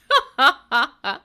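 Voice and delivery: loud burst of real laughter; big open laugh. Real, not acted. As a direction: laugh hard at your own bit. Laughs after own remark.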